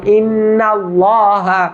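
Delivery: ইন্নাল্লাহ chanted very slowly and drawn out; model pronunciation. Shown as a demonstration. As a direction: stretch ইন্নাল্লাহ like a recitation. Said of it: "এইভাবে আমরা ইন্নাল্লাহ বানান করে দিলাম".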